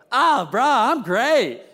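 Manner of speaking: mock-casual bro cheer; fake upbeat. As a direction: brush it off like a bro. Fake-cheerful guy answer.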